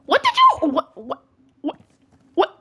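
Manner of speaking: usual speaking voice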